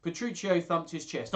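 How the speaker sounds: plain reading voice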